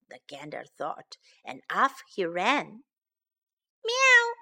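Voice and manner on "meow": high, loud meow; cat's meow. A narrator's reading. Barn cat calls down to the hedgehog, meowing. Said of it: "the barn cat called down".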